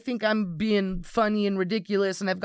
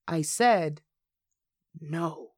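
low, quiet imitated deadpan refusal; dry deadpan; 6 semitones lower, 3 dB quieter, much slower than usual. Coworker refusing a snack offer, imitated dry deadpan. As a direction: drop it low and dry, pausing before the last word. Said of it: "he has this really dry sense of humor".

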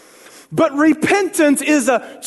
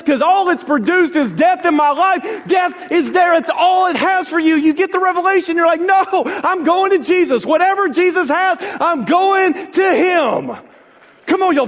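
preaching shout; 7 semitones higher than usual. Real, not acted. Preacher urging the church to turn, full-force shout.

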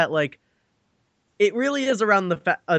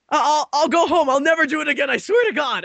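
put-on pleading kid; 9 semitones higher, 4 dB louder, faster than usual.

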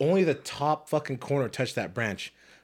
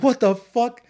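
much higher and louder disbelief; shocked outburst; 13 semitones higher, 8 dB louder, slower than usual. Retold or imitated. Tree falling on truck, shocked high yell. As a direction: yelp it in shock. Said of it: "And I'm like".

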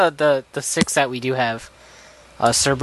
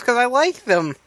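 whiny; 9 semitones higher than usual. Acted. Playful argument over toys.

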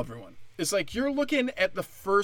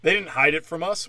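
ordinary talk, nothing marked

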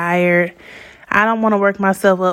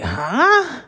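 higher-pitched, taken-aback huh; retold stunned question; upset disbelief; 5 semitones higher than usual. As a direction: react as if you can't believe it. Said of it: "So I was really upset".

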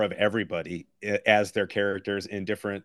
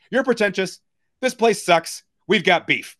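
higher and louder, hammed-up deadpan insult; mock loud retort; 9 semitones higher, 6 dB louder than usual. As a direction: bark the insults like a movie hero, half joking. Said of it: "he goes".

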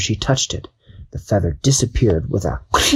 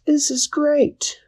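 much higher, slow, amazed cry; joyful Dashi; slower than usual. Acted. Dashi lifting off the ground, high amazed cry. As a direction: marvel at flying.

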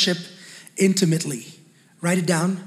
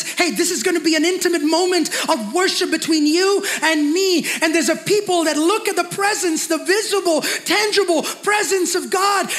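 rising preacher fervor; 10 semitones higher than usual. Real, not acted. Preaching climax, rising intensity.

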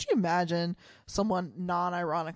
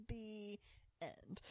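very soft, drawn-out storybook close; mock-solemn ending; 20 dB quieter than usual. Co-host closing the recap, mock-solemn. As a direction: say it hushed, pausing before "end".

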